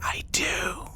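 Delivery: unvoiced confiding whisper. Coworker confides to Janelle she knows, whispered. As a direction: admit it under your breath. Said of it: "what are you two whispering about".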